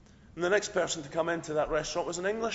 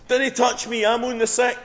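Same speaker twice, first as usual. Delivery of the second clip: high, loud Scottish yell; alarmed yell; 9 semitones higher, 10 dB louder than usual. Acted. Young Scottish Ned warding off Jesus, yelling. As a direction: yell it in broad Scots to fend someone off. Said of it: "who jumps up and yells".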